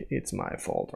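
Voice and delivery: hushed, low confession; quiet admission. Acted. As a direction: own up to it under your breath. Acts an adult admitting blame.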